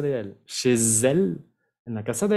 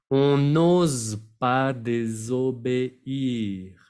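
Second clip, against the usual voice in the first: On n'ose pas désobéir slow, each syllable distinct, n'ose with a z; much slower than usual. Shown as a demonstration. Teacher modelling On n'ose pas désobéir slowly. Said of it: "Bien pronunciar cada sílaba".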